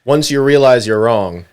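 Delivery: high, loud mock encouragement; almost sarcastic. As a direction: tease with over-the-top confidence in them. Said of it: "it was kind of sarcastic almost".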